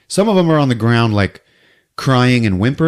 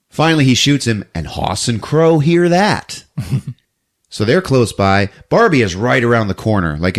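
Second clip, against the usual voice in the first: ordinary talk, nothing marked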